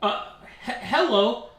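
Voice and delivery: nervous newcomer tripping over the first word; awkward. Acted. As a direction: stammer a hesitant hello. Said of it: "[name] says awkwardly".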